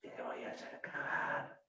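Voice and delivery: whispered mafia-boss threat; El Padrino whisper. Retold or imitated. Coach imitating the Godfather's quiet threat, whispered. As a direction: whisper the threat, no need to shout. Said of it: "El Padrino dice".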